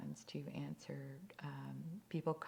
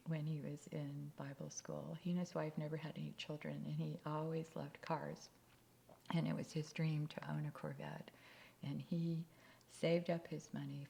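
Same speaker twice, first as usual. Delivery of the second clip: ordinary delivery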